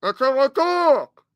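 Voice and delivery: words slurred together, hard to understand; mumbled voice. Pretend. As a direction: mumble it like a mouthful. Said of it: "I can't understand you".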